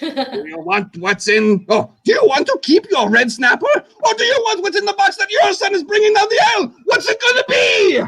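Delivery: high, shouting, overwrought quiz host; hammed-up host building to a wailing shout; game show host impression. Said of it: "I'm gonna do my best impression".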